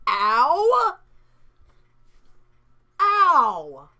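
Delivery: loud pained cries; pained yelp. Real, not acted. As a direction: yell out in pain. Trap snaps tongue.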